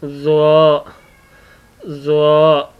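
ज़्वा thick and heavy, tongue tip on the upper gum; heavy letter demo. Shown as a demonstration. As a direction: repeat ज़्वा heavily for the class. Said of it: "इसको मोटा पढ़ा जाता है".

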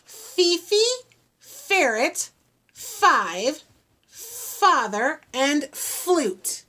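each F word alone, "Fifi", "ferret", "five", "father", "flute" with crisp F; F sounds spelled out. Shown as a demonstration. Teacher showing the kids the F sounds. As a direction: list the F words slowly, one by one. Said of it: "Do you hear those F sounds?".

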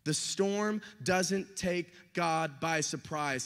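ordinary delivery

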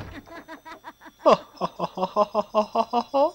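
loud rising high cackle; high cackling laugh. Real, not acted. Gamer cracking up at a funeral line, high loud cackle. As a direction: burst into a high shrieking laugh.

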